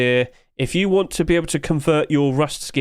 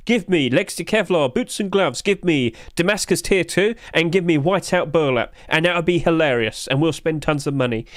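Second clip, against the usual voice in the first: usual speaking voice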